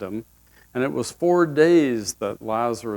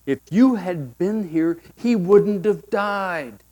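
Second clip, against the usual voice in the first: reproachful mourner; 5 semitones higher, slower than usual. Acted. Reproach to Jesus.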